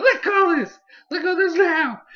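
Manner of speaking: high, loud mock shriek; demanding yell. Someone jumping on a thief to recover an invention, high demanding yell. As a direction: yell it high, as if wrestling something away. Acted struggle over the stolen invention.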